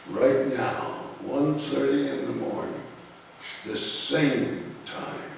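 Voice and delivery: slow, measured poetry reading voice; poem reading. Acted. Poet reading his elegy for Ray to an audience, measured and slow. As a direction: let each line land like a reading.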